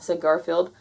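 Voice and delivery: ordinary delivery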